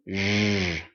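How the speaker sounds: "Zh." held as a long voiced buzz; zh sound alone. Shown as a demonstration. Teacher isolating the zh sound for the class, buzzing. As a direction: hum the zh sound on its own. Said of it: "Zh, as in".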